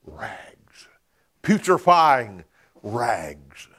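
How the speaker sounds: sudden whispered word in the sermon. Acted. Preacher hushes on disgust word.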